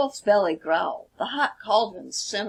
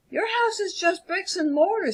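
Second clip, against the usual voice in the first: much higher sneering voice; scoffing wolf; 10 semitones higher than usual. Acted. Wolf scoffs at the three pigs' brick house. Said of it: "said the wolf".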